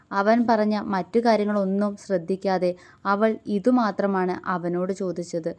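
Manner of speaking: ordinary delivery, nothing marked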